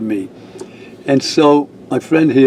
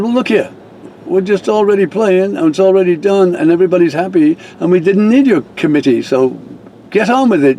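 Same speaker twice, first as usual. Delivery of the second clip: higher, mildly heated retold retort; livid; 7 semitones higher than usual. Reverend telling the activities lady off, mildly heated. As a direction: push back and brush her off. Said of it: "so I got livid".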